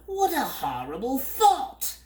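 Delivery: very high, loud horrified bird cry; shuddering bird. A narrator's reading. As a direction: recoil in mock horror. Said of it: "the bird shuddered".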